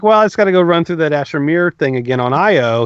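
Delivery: ordinary talk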